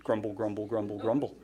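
muttered mock complaint, low and soft; mock grumbling. Shown as a demonstration. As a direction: demonstrate griping under the breath. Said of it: "This will transform your attitude".

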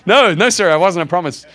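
loud, high protest of innocence; hasty mock denial. Believer denying to God he noticed her, mock protest. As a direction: deny it emphatically, playing for laughs.

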